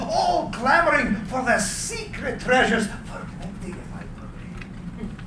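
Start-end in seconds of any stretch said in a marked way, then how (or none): 2.9-5.3 whispered, trailing aside, slow at the end. Acted. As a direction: breathe it out like a private thought. Tesla's wistful aside.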